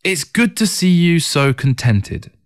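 slow, wistful sigh of a remark. A narrator's reading. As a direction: say it slowly, like a sigh. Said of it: "Xialing sighed".

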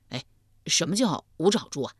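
ordinary delivery, nothing marked

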